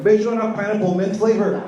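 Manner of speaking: put-on high, loud, cheeky baby voice; a mock baby's first words, high and brash; sassy baby voice. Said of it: "I heard the baby say her first words".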